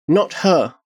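put-on high-pitched little girl's voice; child's confession. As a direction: finish the thought in a high child voice. Child finishes after deep breath.

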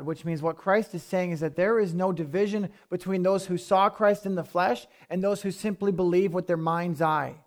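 normal talking voice

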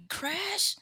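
high, questioning yelp; surprised. Retold or imitated. Seeing the Crash Bandicoot sponsor, surprised.

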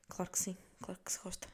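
soft breathy whisper; whispered aside. Real, not acted. To viewers, confiding she liked it, whispered. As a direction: confide it under your breath.

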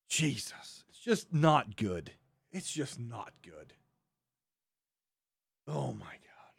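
fading to a whispered "Oh my God"; exasperated mutter. Real, not acted. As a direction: let it drain out, worn out. Exasperated after rant.

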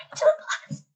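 breathless high squeal; excited. Real, not acted. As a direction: gasp it out in delight. Excited to get a reply.

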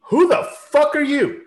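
high, loud, slow challenge to a newcomer; brash demand. Retold or imitated. Woman at the head of the table confronting a latecomer, loud high demand. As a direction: bark it at the stranger in the doorway. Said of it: "she's like".

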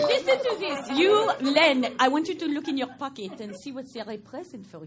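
put-on playful French-accented coaxing. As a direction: coax him with a French accent. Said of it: "it's a French accent she's doing".

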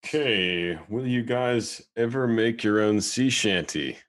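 ordinary talk

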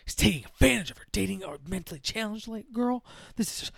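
mock huffing, outraged mother; huffy indignation. Mother objects to daughter's boyfriend, imitated huffiness. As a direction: huff and puff through mild mock outrage. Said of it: "she's all huffle puffing about it".

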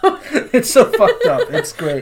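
slow, laughing, high-pitched delight. Real, not acted. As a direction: laugh through it. Laughing at the story.

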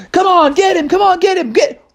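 high, excited egging on; urgent urging. Acted. Bears cheer on the chase in the bus, high excited urging. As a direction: urge them on in a high voice. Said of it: "everybody was like".

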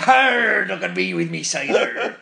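West Country pirate, arr. Pretend. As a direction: play a cider-loving West Country pirate. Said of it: "Bit West Country pirate".

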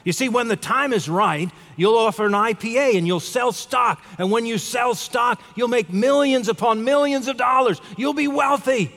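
acted exuberance, high and climbing; gleeful tourist. Tourist promising the fisherman millions, mounting glee. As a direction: build excitedly to a high-pitched triumphant finish. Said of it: "enjoyed himself so much, he just laughed out loud".

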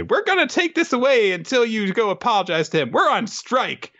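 high, defiant creature voice; hermit crabs. Acted. Crabs rebel against their leader, high and defiant. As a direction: declare it in a squeaky crab voice. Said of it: "and they say".

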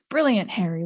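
usual speaking voice

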